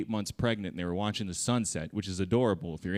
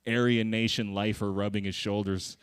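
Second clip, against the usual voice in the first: ordinary delivery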